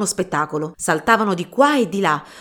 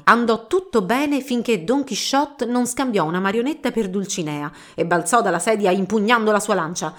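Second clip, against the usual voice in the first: plain reading voice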